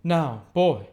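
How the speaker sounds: loud high call; loud imperious call. Acted. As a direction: summon the boy sharply. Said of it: "caused Estella to say".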